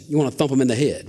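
ordinary talk

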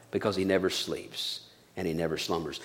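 very soft, low hushed line. Acted. Preacher to the congregation, repeating the psalm in a hush. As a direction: drop to a near hush for the promise.

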